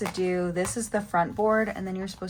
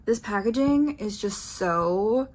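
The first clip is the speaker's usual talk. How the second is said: usual speaking voice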